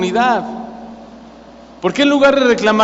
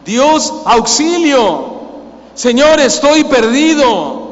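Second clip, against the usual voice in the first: raised, stretched-out plea to God; crying out; 4 semitones higher, much slower than usual. Acted. Preacher models shouting to God for help, fervent cry. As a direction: cry out higher, drawing each phrase long. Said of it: "es gritar".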